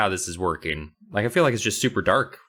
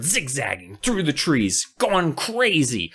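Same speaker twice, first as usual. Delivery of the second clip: normal talking voice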